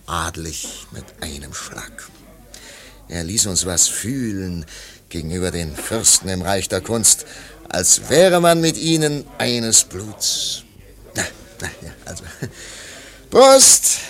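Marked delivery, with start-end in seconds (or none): 0.1-2.1 hushed, whispered awe; reverent whisper. Acted. Man whispers in awe about her father. 13.3-14.0 high, loud cheers; loud toast. Acted. Man toasts loudly over his wine.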